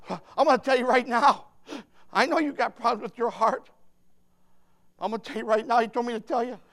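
usual speaking voice

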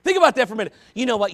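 ordinary talk, nothing marked

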